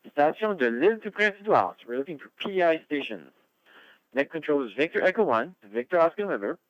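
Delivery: high, loud radio call-out; net control announcer. Real, not acted. Net control calls stations.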